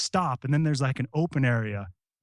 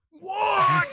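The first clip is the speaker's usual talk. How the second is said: high shouted disbelief; shocked what. Retold or imitated. Soldier shouting at Iraqi kids, shocked. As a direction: yell it out in shock.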